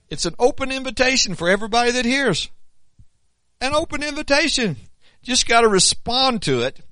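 high, loud preaching at full force. Acted.

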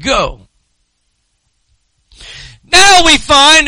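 usual speaking voice

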